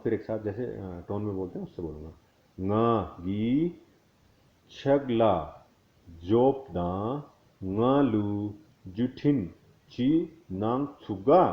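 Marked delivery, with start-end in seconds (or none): 2.6-11.4 each word spaced out, slow and careful; word by word slowly. Shown as a demonstration. Teacher to learners, spelling out each word slowly. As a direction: lay out every word on its own, very slow. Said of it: "मैं एक-एक word पहले धीरे-धीरे बोलता हूँ".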